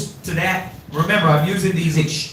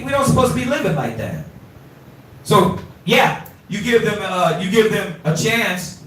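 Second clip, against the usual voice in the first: normal talking voice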